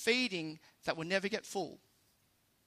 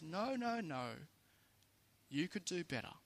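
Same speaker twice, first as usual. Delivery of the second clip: lightly voiced nagging inner voice, softer; little inner voice; 9 dB quieter than usual. Voice in the back of his brain urging more, softly nagging. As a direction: quietly play a small voice in the head. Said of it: "this little voice in the back of my brain".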